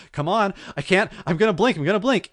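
high, urgent imitation of panic; freaking out. Trapped man tells his rescuer he can't hold out, acted panic. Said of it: "he's freaking out".

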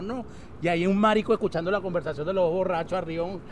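ordinary delivery

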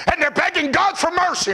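high, urgent pulpit burst; impassioned preacher's cry; sudden preaching shout. Acted.

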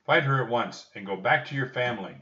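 loud, firm captain's command; ordering. A narrator's reading. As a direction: give a brisk military order. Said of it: "the captain ordered".

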